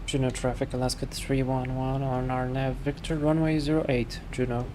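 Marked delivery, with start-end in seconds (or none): none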